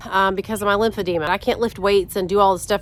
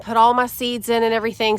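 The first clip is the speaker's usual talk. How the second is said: usual speaking voice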